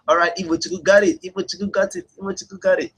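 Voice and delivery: high-pitched cheer for the winner; excited announcing. Real, not acted. Host cheering a viewer who guessed right, high and excited. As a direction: call out the winner with glee.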